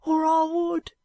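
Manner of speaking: put-on high, pleading boy's voice on the edge of tears; a frightened ragged boy refusing; tearful street boy. Joe refuses again, near tears.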